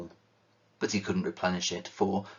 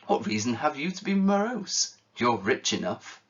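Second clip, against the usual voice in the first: much higher, playful teasing retort; gaily; 6 semitones higher than usual. Acted. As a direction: tease the old uncle cheerfully. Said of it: "returned his nephew gaily".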